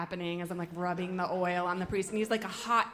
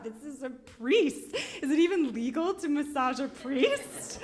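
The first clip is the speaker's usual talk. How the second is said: higher, louder, laughing through the panic; panicked laughing disbelief; 5 semitones higher, 3 dB louder than usual. Retold or imitated. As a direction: giggle in shocked disbelief at a priest. Panicking over a priest client.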